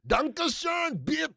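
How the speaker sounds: high, shouted cartoon German accent; mock German accent. Host showing off to puzzled co-host, shouted mock German accent. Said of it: "That was my German accent".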